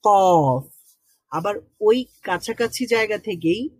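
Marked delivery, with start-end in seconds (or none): 0.0-0.6 কফ hit hard with the back of the tongue on the palate, louder; back tongue sound. Shown as a demonstration. Teacher demonstrating কফ to learners. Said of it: "জিহ্বার গোড়া উপরের তালুর সাথে লাগিয়ে".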